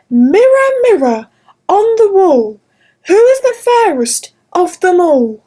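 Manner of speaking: a proud queen calling on her mirror; vain queen. Acted. Queen asks magic mirror.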